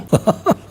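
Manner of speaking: short laugh bursting out after speaking; real laugh. Real, not acted. Host laughing at their own rhetorical question, short laugh.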